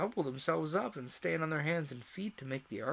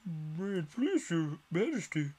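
slow, lightly raised humble plea; a hint of a humble gardener; 5 semitones higher, 3 dB louder, slower than usual. A narrator's reading. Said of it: "in a very humble tone".

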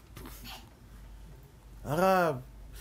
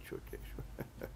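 whisper; 19 dB quieter than usual. Acted. Whispered repeat of drinking.

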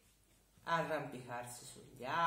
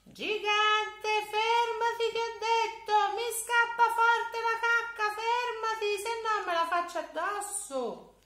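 very high, loud, whining plea; a child pretending he desperately needs the toilet; boy begging the giant to stop; pleading little boy; 11 dB louder than usual.

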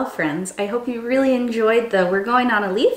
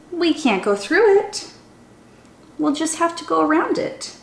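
lively, sing-song storybook reading; animated children's story voice; 4 semitones higher than usual. A narrator's reading. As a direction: read it like a chant for little kids. Reads a picture book aloud.

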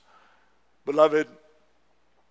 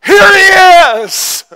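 exclaiming; 17 dB louder, much slower than usual. Acted. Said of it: "Simeon said".

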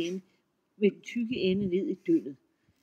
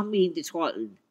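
plain reading voice

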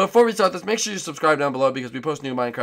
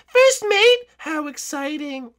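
put-on high sweet girly voice; Birdo voice; 12 semitones higher, slower than usual. To Yoshi in the morning, high sweet Birdo voice. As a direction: pitch way up as a sweet girlfriend.

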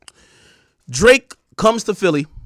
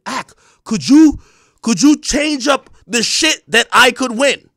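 higher, louder, drawn-out mimicked begging; mock delusional plea; 6 semitones higher, 6 dB louder, slower than usual. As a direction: imitate a deluded man pleading, slowly. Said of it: "This delusional motherfucker was literally saying".

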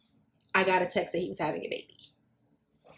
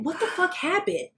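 baffled; 9 semitones higher than usual. Retold or imitated. Demands to know what happened.

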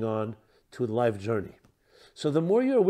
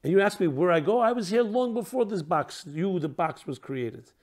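higher, affronted retort; incredulous indignation; 3 semitones higher than usual. Acted. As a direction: play electricity offended by the question. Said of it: "responds with an incredulous indignation and tone".

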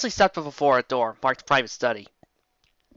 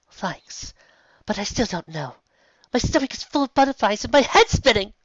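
put-on high young voice; 9 semitones higher, 3 dB louder than usual. Luna's small voice.

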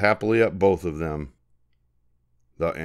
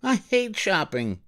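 put-on higher, slow sulky kid voice; grumpy child voice; 13 semitones higher, slower than usual. Harry tells his mother he hates shopping, slow sulky child. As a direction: drag it out like a sulking boy.

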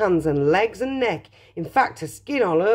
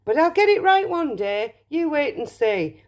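wistful Wonka; 6 semitones higher than usual. Acted. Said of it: "sighed Mr Wonka".

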